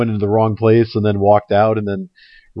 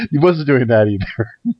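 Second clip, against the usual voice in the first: ordinary delivery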